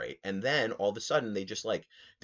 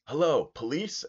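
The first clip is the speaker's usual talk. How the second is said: 1 loud phone call; 8 semitones higher, 6 dB louder than usual. Retold or imitated. Cabbie mock calls police.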